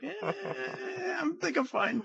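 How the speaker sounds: high, slow whining drawl; brushing off the captain's advice in a high, slow whine; whiny brush-off. Retold or imitated. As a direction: stretch it out like a whining kid.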